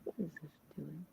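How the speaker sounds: usual speaking voice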